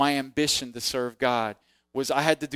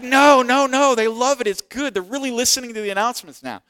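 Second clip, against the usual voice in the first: higher, louder, upbeat boss voice; eager insistence; 10 semitones higher, 8 dB louder than usual. Retold or imitated. Pastor insists the kids love the announcements, high loud insistence. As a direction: wave off the doubt, brightly insistent and high. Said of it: "It's like".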